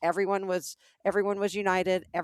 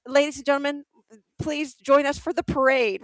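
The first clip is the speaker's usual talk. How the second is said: higher, louder announcer call; 8 semitones higher, 8 dB louder than usual. Retold or imitated. Retelling how the park announced the surprise parade, announcer voice. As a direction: boom it out like a park loudspeaker. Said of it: "they announced on the P A".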